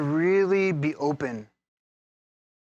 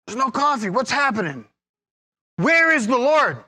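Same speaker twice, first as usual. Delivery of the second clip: starts as a bitter grumble, ends higher and louder in a hammed-up wail; bitter complaint building to a mock wail; 6 semitones higher, 4 dB louder than usual. Pastor reenacting his no-coffee complaint at church, bitter mock wail. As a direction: ham up the groggy despair, climbing into a loud lament. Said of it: "like, bitterly".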